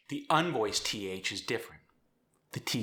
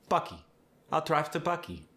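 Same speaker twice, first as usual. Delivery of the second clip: South African accent; 6 semitones higher than usual. Shown as a demonstration. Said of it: "your South African accent".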